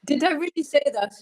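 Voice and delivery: can't believe she said it; amused disbelief. Real, not acted. Shocked at being quoted.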